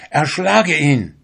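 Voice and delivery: high, loud, drawn-out order; urgent command. A narrator's reading. Soul orders the fisherman to kill, forceful command. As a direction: urge the deed with force.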